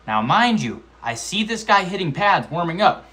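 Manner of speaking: usual speaking voice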